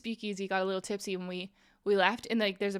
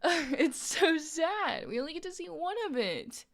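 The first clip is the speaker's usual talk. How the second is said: hammed-up heartbreak with a laugh in it; mock tearful lament; 4 semitones higher than usual. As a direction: lament it like you could cry, half laughing. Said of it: "that made me wanna cry".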